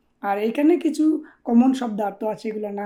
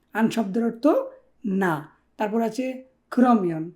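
ordinary talk, nothing marked

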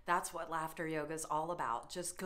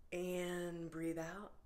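slow, softer, drawn-out guidance; slow breathing cue; 5 dB quieter, much slower than usual. Shown as a demonstration. Yoga leader guiding the class's breath out, slow and soft. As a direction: ease the words out slowly and softly.

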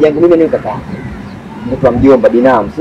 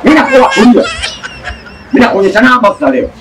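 menacing threat; 8 semitones higher than usual. Acted. Threatening a rival, loud.

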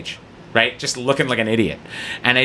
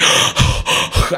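big voiceless gasp of delight; mock thrilled gasp. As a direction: gasp like an overjoyed fan. Thrilled fan asked into bit.

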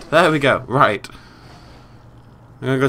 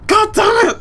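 very high, very loud scream; real high-pitched outburst of rage; frustrated scream; 12 dB louder, much slower than usual. Real, not acted. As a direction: scream in defeat at the game. Losing in the game.

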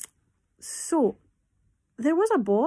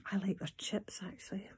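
soft confiding whisper; 10 dB quieter than usual. Acted. Teacher whispering her fondness for chips. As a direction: let them in on a secret.

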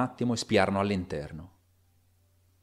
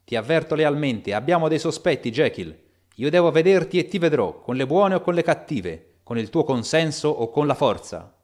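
raised, higher, louder threat; loud warning; 5 semitones higher, 6 dB louder than usual. A narrator's reading. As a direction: warn him through the door, forceful. Threatening through locked door. (minaccia ad alta voce)

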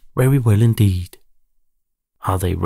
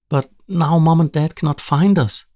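crying out; 7 semitones higher than usual. A narrator's reading. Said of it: "Nila cries".

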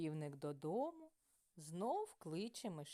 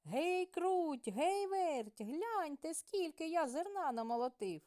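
louder, higher hail to the mice; shouting; 8 semitones higher, 5 dB louder than usual. A narrator's reading. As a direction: call out proudly across the yard. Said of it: "та й гукнув".

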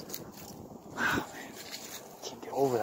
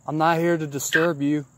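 calling out to spirits; 4 semitones higher, 6 dB louder than usual. Real, not acted.

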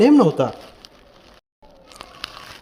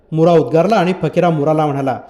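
ordinary delivery

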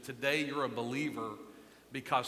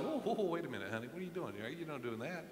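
gentle parental correction; 8 dB quieter than usual. Retold or imitated. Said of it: "I'd have said".

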